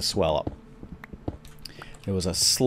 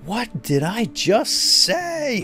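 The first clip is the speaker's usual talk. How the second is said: high, slow, scolding yell; retold angry outburst; 13 semitones higher, much slower than usual. Dad scolding the kids after the rock hit: mock angry yell. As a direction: replay the moment you blew up at the kids. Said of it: "Of course, I blew up".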